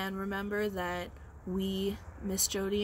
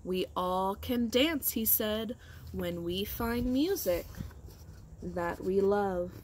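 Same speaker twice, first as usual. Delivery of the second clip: storybook reading; 3 semitones higher than usual. A narrator's reading. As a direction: tell the tale to a class of kids, building up. Teacher reads picture book aloud.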